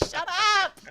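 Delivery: loud laughing shout; laughing shut up. Real, not acted. GM telling a teasing player to shut up, laughing loudly. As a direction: laugh it at your friend. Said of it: "ignore that you love all people".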